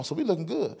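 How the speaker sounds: usual speaking voice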